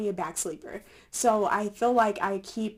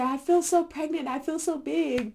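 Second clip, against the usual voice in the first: dramatic; 7 semitones higher than usual. Retold or imitated. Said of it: "all my updates say".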